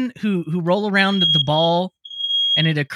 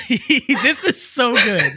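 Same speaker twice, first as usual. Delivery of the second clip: words spilling through laughter; laughing; 4 semitones higher, much slower than usual. Real, not acted. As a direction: laugh while you say it. Guest's fire alarm goes off.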